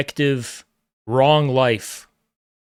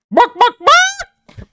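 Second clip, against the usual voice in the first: much higher, drawn-out squawking bark; mock chicken squawk; 5 dB louder, much slower than usual. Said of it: "You're a chicken".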